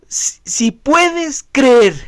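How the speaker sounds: very slow, each word weighed; slow emphatic quote. Shown as a demonstration. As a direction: lay the words down slowly. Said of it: "Aquí el Señor dijo". (cita muy lenta y enfática)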